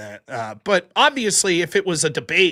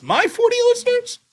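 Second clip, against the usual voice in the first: mock incredulous; 3 dB louder than usual. Real, not acted. Mocking his own audience size.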